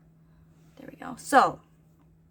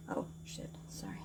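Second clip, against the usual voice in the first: hushed whisper of a slip-up; whispered oops; 16 dB quieter than usual. Real, not acted. Vlogger catches herself swearing on camera, whispering. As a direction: whisper the curse and apology under your breath. Slip-up while filming spiders.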